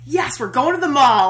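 loud, high burst of glee; excited cheer. Retold or imitated. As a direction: cheer it like a thrilled fan. Retelling own glee on seeing Nadia.

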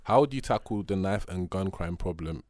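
usual speaking voice

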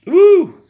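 loud high hiccup squeak; a sudden hiccup that jumps up high; hiccup yelp. Real, not acted. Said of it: "I got the hiccups".

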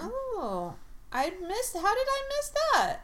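high, astonished question; surprised. Real, not acted. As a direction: squeal in disbelief. Surprised at the news.

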